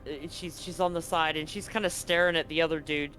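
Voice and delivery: ordinary talk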